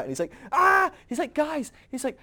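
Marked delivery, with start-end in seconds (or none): 0.5-0.9 high, loud mock scream; panicked scream. Jesus acted out after the fruit is eaten, panicked scream. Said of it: "he's pulling his hair out". 1.3-1.7 high, loud mock panic; freaking out. Jesus acted out calling the others, mock panic. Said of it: "I'm freaking out".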